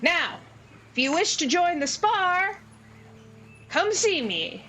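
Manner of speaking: high-pitched, projected gnome announcer; booming little showman welcoming a crowd. Acted. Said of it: "bizarrely booming voice".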